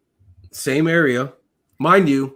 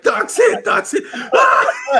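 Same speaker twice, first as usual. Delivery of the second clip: very high, louder hysterical squeal; mock panicked screaming; 5 dB louder, much slower than usual. Medic imitates his squad finding him hit, hammed-up panicked screaming. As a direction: imitate buddies losing it, hammed-up. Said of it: "they lost their shit".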